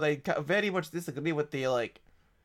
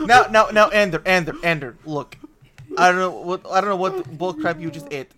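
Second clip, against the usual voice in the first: much louder, urgent scolding; loud protest; 7 dB louder than usual. Acted. Player yelling at Ender to defend the food, loud. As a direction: shout Ender down.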